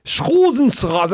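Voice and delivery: very high, loud, drawn-out German; insistent German uncle. Retold or imitated. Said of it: "My uncle is very German".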